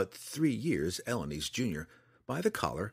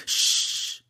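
loud, forceful shush; hushing; 6 dB louder than usual. A narrator's reading. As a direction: hush someone urgently. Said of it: "slapped a finger over their lips and said".

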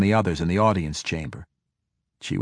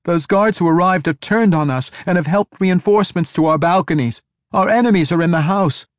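high, loud alarmed report; panicked young messenger; frantic; 9 semitones higher, 6 dB louder than usual. A narrator's reading. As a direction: pour out the danger in a raised, urgent voice. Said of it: "The frantic young woman".

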